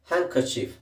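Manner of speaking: handkerchief as hankerchif, d dropped; pron. Shown as a demonstration. Teacher shows handkerchief needs no d sound. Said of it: "ಡಿ ನ ಹೇಳೋ ಅವಶ್ಯಕತೆ ಇಲ್ಲ".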